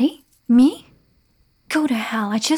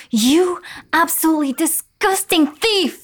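loud higher angry outburst; furious insult; 6 semitones higher, 4 dB louder than usual. Acted. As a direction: spit the insult at him. Gown ripped to pieces.

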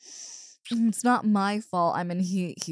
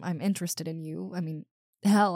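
usual speaking voice

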